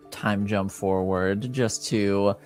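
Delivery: ordinary talk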